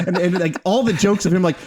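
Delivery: ordinary delivery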